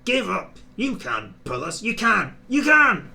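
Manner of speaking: trucks jeering at James to give up. Acted. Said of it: "called the trucks".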